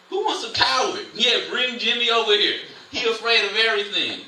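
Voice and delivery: preacher mocking a fearful soldier, sarcastic. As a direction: hype up the coward with sarcasm. Sarcastic mock of a coward.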